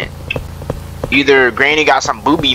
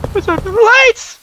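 high alarmed shout. Real, not acted. Gamer noticing the lights, "Who turned on the lights?" shouted high. Repeated louder.

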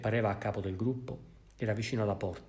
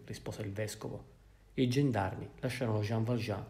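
usual speaking voice

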